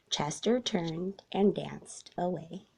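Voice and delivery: ordinary delivery, nothing marked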